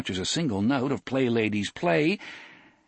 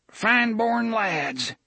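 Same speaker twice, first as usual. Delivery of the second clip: put-on high, slow, drawn-out old man; 13 semitones higher, slower than usual. A narrator's reading. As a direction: savour the words, old and high.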